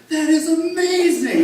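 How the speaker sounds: put-on very high, loud, slow girl's voice; amazed daughter. Dad voices his daughter marvelling at the placenta, high and amazed. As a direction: gush with wonder.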